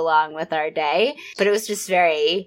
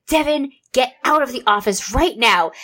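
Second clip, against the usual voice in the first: mock yell; 6 semitones higher than usual. Mom ordering her son out of the office, mild mock yell. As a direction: call him out of the office, half-shouting. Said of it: "And then I yelled".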